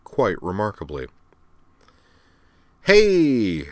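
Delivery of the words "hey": high, loud, drawn-out greeting; delighted. A narrator's reading. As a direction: greet her with open delight. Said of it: "said Al delightedly".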